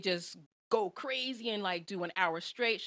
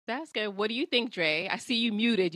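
normal talking voice

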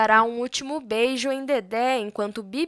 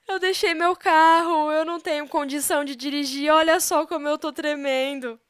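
higher, shaken voice of a frightened woman; shaken and upset; 5 semitones higher than usual. Acted. Shaken mother telling her family she was robbed, higher and rattled. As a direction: sound rattled after the robbery. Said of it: "completamente abalada".

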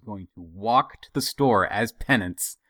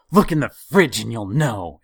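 curt angry mother-in-law; 3 semitones higher, 8 dB louder than usual. Retold or imitated. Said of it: "and stormed off to her room".

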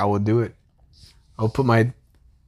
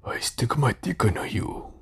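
softer mock Japanese accent; mock Japanese porn actor; 3 semitones lower, 8 dB quieter than usual. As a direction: put on a hammy Japanese accent, hushed. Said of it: "the guys in the porno".

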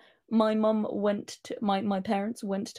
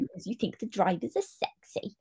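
breathy mock-accusing whisper; teasing whisper; 3 semitones higher, 5 dB quieter than usual. Fan teasing co-host about drivers, stagey whisper. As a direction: whisper the taunt playfully.

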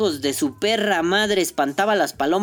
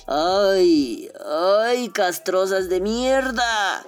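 drawn-out exasperation; 2 semitones higher, much slower than usual. Retold or imitated. Inner rant at coughing women.

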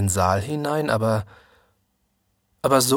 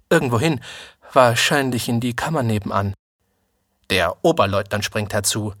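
usual speaking voice

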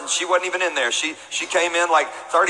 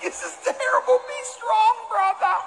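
much higher, hammed-up anguished little brother; mock anguish. Boy outside the door mock-grieving for his brother. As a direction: wail it dramatically for laughs.

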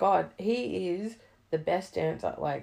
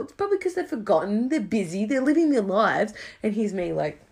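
ordinary talk

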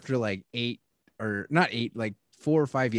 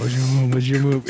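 imitating a drunk friend, louder and slurred; mock drunk slur; 7 dB louder than usual. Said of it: "he's mumbling and slurring".

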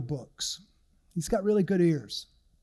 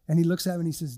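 ordinary talk

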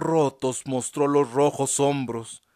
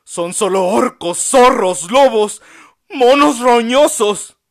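loud, high, taunting outburst, slowing at the end; provoking insult; 10 semitones higher, 12 dB louder than usual. A narrator's reading. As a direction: hurl the insults louder and higher. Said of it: "los provocó".

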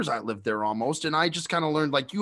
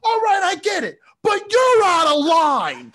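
lampoon his tough-guy comeback; imitating Bob, out of line; mocking Bob; 12 semitones higher, 7 dB louder than usual. Bob's comeback retold, mocked.

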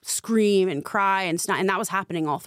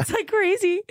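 high-pitched, overcome little laugh. Real, not acted. Telling listener about dad's message, laughing in disbelief. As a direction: laugh through the words, voice jumping up.

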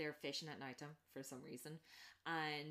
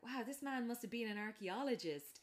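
mock wonder, rising louder at the end; imitating a sweet simpleton's awe; naive amazement; 6 semitones higher, 6 dB louder than usual. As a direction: gush over the bones innocently. Dale voiced, naive amazement.

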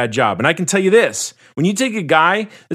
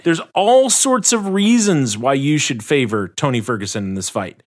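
ordinary talk, nothing marked

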